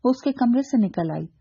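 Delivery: ordinary delivery, nothing marked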